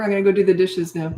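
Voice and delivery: ordinary talk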